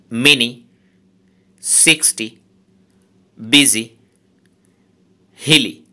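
each word from sibling to Hili hit on its first syllable; word list, first beat strong, second light, as in busy; first-syllable stress. Shown as a demonstration. Teacher showing students stress on weak-syllable words, first-syllable stress in sibling. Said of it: "সেই সিলেবলটাতে stress হবে".

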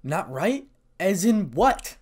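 baffled, pitch jumping up; confused. A narrator's reading. Issei questioning Kiba in confusion. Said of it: "asked Issei in confusion".

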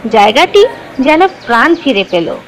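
ordinary delivery

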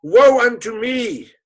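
loud, high, slow lament; father's anguished wail at losing his son; wailing cry. Acted. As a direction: cry it out to the heavens in grief. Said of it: "And he cried".

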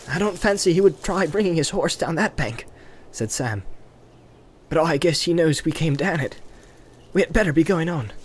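a loyal servant weighing the danger; Sam, cautious. A narrator's reading. Sam's dialogue in read story.

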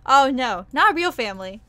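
loud, flustered correction; laughing protest. Real, not acted. Vtuber loudly correcting chat about her mom. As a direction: blurt out the correction.

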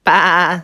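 loud wobbling bleat; big sheepy baa; sheep's baa. A narrator's reading. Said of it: "said the sheep".

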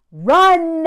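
a sudden loud warning. Real, not acted. Telling viewers to flee, loud warning. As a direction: bark the single word as a real warning. Warning women off such men.

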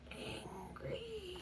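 quiet teasing echo; soft high echo. Shown as a demonstration. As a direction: whisper the word back gently. Repeating the feeling word.

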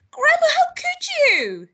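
very high, loud, drawn-out cry; horrified boy. Acted. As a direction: wail in shock as a little boy.

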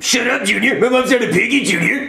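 high-pitched, indignant comeback; indignant retort. Acted. Indignant retort to mother insult.

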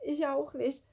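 high, tearful whine; whimpering. A narrator's reading. Elmar refuses too, whimpering on the verge of tears. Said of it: "wimmerte Elmar".

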